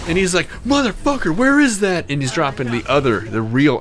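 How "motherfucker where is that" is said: high-pitched, imitated angry demand; mock accusatory. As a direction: act out an accusing outburst, pitched up. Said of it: "in an accusatory tone".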